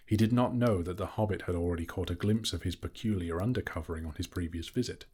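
usual speaking voice

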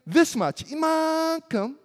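put-on child's playful answer. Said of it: "And I would say".

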